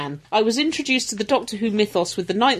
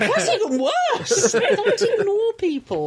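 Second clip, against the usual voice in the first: laughing scold; 12 semitones higher, slower than usual. Acted. Mock scolding while laughing.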